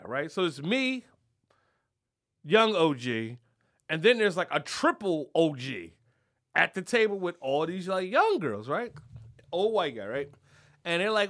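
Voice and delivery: usual speaking voice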